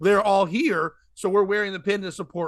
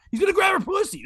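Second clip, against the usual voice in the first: high-pitched comic outburst; hammed-up scandalized cry; mock alarm; 12 semitones higher, faster than usual. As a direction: shoot up high in mock shock. Mock outrage at the father.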